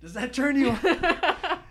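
lightly voiced sleazy guy; mock creep. Repeating his friend's line as a sleazy creep.

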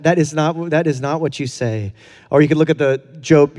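usual speaking voice

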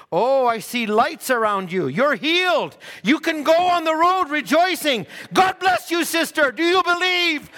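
high, loud, ringing pronouncement of healing; fervent healing cry. Acted. Healer shouting blessing over the healed sister, loud and high.

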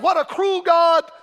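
drawn-out mock yell of protest; retold yell. Preacher quoting the man who interrupted him, yelling. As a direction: call it out slowly like the heckler. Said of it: "But he yelled".

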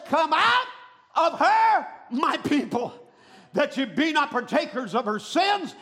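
loud, ringing call, easing at the end; voice from heaven. Acted. Voice from heaven summons God's people out, loud preached call. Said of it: "I heard another voice from heaven".

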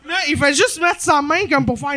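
ordinary talk, nothing marked

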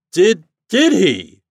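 high, loud, drawn-out question; slow, booming long-distance reply; captain shouting back. A narrator's reading. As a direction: holler it slowly across the water.

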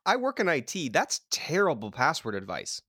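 normal talking voice

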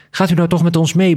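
high, pleading read-aloud voice; begging. A narrator's reading. Daughter-in-law begging the father to come along, pleading. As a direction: plead with him, pitch lifted high. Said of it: "begon ze ineens te bedelen".

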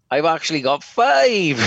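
high, loud call from the crowd; retold shout. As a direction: shout it up from the audience, half-mock. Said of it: "And he shouts up".

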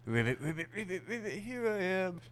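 high croaky frog. Pretend. Plays frog answering.

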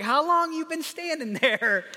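high, drawn-up puzzled question; playfully baffled parent; mock bewilderment. Waking parent asks the staring child, mock bewilderment.

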